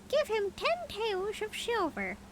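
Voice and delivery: "Give him ten taels of silver" very high and slow as the mynah; high bird voice. A narrator's reading. Mynah bargaining with the prince, high bird voice. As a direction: redo it squeaky and high like the bird. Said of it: "continued the bird".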